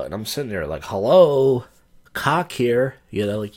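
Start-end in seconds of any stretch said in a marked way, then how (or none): 0.8-2.9 loud retort. Pretend. Imagined retort to man-hating bartender.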